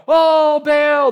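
high, loud mock cry to Baal; mock pleading cry. Preacher mocking prophets crying to their god, loud high cry. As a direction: call out to Baal like a frantic prophet.

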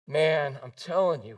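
ordinary talk, nothing marked

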